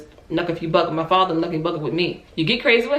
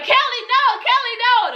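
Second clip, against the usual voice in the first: pitched way up, urgently pleading; high frantic plea. Retold or imitated. Friend pleading with Kelly to stop, high and frantic. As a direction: call her back, high and desperate. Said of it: "my other friend was like".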